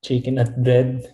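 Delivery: put-on "chicken nut bread" in Filipino accent. Guest copying the meme's punchline, Filipino accent. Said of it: "I love your Filipino accent".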